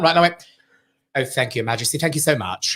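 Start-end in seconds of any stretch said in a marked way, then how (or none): 1.1-2.7 hammed-up posh English, lower and soft; posh accent. Performer surprises the royal guest with a posh accent. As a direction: lay on the poshest accent. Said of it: "I'm gonna put the most posh accent I can on".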